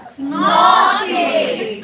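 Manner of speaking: nasal mock-British call; British dialect. Shown as a demonstration. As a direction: belt out SNORTING in haughty, nasal British. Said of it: "Say that with me in a British dialect".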